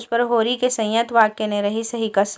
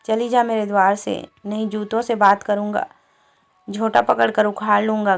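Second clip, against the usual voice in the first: plain reading voice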